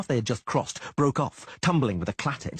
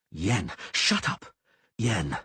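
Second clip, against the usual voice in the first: desperate plea; much slower than usual. A narrator's reading. Geralt pleading.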